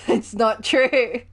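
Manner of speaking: slow, laughing emphasis. Real, not acted. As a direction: laugh it out slowly, amused. Repeats with laugh, slower.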